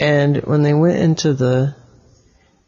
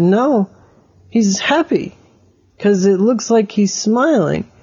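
put-on lightly raised kid's voice insisting; higher child voice; 6 semitones higher than usual. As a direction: play young Oliver arguing back, pitched up. Oliver voiced, arguing back.

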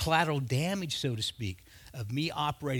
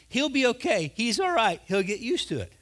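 higher, louder breezy doctor reassurance; mock cheery doctor telling the wife he's fine; doctor reassuring wife; 8 semitones higher, 5 dB louder than usual. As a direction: brush off her worry cheerfully.

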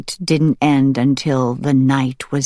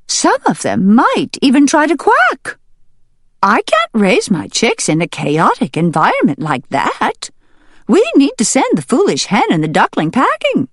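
very high, louder hen voice; indignant hen; 12 semitones higher, 4 dB louder than usual. Acted. Hen protesting to the barn meeting, very high and shrill-loud. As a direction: squawk your complaint, high and insistent. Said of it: "The hen spoke up first".

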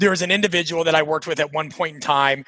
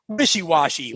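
normal talking voice

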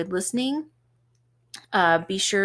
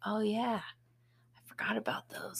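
a hushed, sneaky whisper; 2 semitones higher than usual. Retold or imitated. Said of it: "I'm like".

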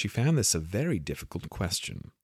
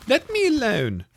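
high and louder; Alice pleading; 7 dB louder than usual. A narrator's reading. Alice fends off the pigeon, high and loud. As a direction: push the bird away with your voice.

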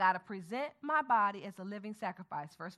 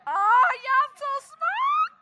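playful praise; 14 semitones higher, 12 dB louder, slower than usual. Acted. Teacher praising class playfully.

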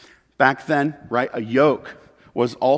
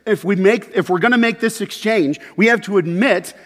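louder, higher preaching push; preaching at full force; 7 semitones higher, 6 dB louder than usual. Acted. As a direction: drive it home to the congregation.

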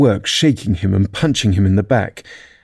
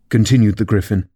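usual speaking voice

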